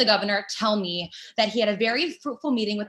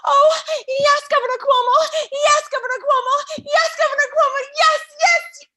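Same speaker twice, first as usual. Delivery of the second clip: high, loud moaning cries of the name; hammed-up ecstatic moan, much higher and louder; 6 dB louder than usual. Woman fantasizing about the governor, moaning his name loudly. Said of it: "I begin to moan too".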